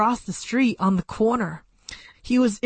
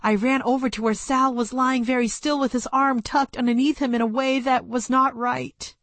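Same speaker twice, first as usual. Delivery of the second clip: plain reading voice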